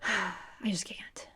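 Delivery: slow, breathy sighed whisper; sighing whisper. Real, not acted. Admitting she can't cry, a slow sighed whisper. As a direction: sigh it out, defeated.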